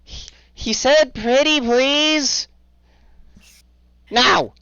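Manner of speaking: very high, loud, frantic goblin voices; panicked creature squabble. Acted. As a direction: argue in a panic like silly little creatures. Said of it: "guard reacting excitedly".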